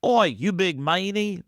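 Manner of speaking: a hammed-up Aussie "Oi, you big meanie"; awful Aussie accent. Host teasing his friend in a bad Australian accent. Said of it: "That was an awful Aussie accent".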